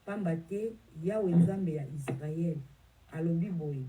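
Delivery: usual speaking voice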